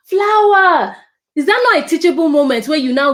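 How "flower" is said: put-on high, loud excited little girl; small child delighted by a flower; child's voice. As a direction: say it like a thrilled toddler. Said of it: "she now tells you".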